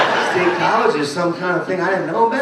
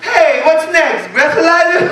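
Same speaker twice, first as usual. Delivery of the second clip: mock cocky taunt; 9 semitones higher, 6 dB louder, slower than usual. Mock drunk taunting the cop.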